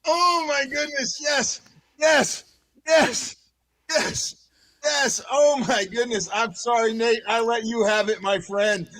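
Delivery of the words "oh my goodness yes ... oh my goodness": high, repeated punched cheers; real fan elation, pitched up; elated celebration. Real, not acted. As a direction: punch out each yes, thrilled. Celebrating a second goal moment.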